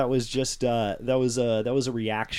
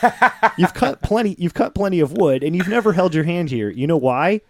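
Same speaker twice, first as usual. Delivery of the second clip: loud, high mock self-scolding; scolding inner voice; 7 semitones higher, 8 dB louder than usual. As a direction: lecture yourself loudly as your own brain. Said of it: "where your brain goes".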